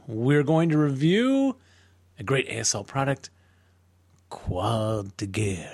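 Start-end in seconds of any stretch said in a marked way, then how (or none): 4.3-5.7 put-on slow, overdone French accent on the name; bad French accent. Podcast host's first take at the French name, bad French accent. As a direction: drag out Quad de Guerre in a clumsy French accent. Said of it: "French accent was pretty bad".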